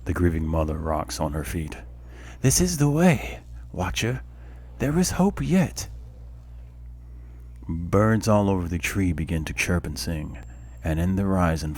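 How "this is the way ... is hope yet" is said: raised, solemn goddess voice; commanding warrior goddess. Acted. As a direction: speak as a lofty deity. Voices goddess after narration.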